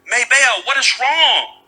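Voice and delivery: hint of a concerned eight-year-old; worried little boy. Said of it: "Little Fred is eight years old".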